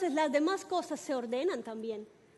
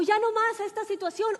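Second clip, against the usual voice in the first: higher, louder voice of refusal; defiant war cry; 5 semitones higher, 5 dB louder than usual. Retold or imitated. Believer refusing a bad situation, raised defiant cry. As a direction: cry out against it with force. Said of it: "es un grito de guerra".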